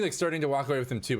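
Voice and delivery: normal talking voice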